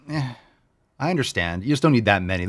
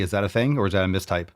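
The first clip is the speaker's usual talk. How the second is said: ordinary talk, nothing marked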